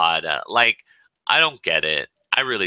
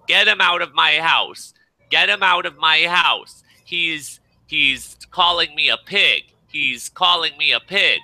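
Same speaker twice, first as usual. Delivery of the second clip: mock screaming; 8 semitones higher than usual. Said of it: "she was screaming at our neighbors".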